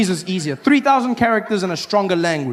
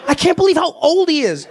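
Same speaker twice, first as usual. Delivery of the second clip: much higher gossiping voice; mocking gossip; 11 semitones higher, 4 dB louder than usual. People gossiping about Biden's age, high and mocking. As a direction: gasp it out, way up high.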